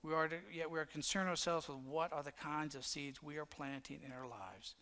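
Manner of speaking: normal talking voice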